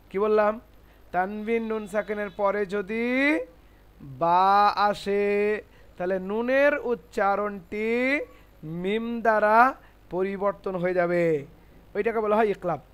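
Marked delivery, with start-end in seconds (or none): 1.1-11.5 slow, drawn-out phrase endings; teacher slowly reciting a rule to memorise; slow dictation. Shown as a demonstration.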